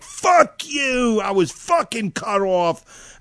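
high, loud retold yell at the driver; furious road rage yell, reenacted; angry yell. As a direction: snap it out at the other car, then drag the rest slowly. Yelling at the other car.

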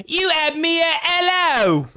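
loud, high, drawn-out movie quote; slow, piercing mock film heroine; Renee Zellweger. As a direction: belt out the line in an exaggerated impression. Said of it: "particularly good when she says".

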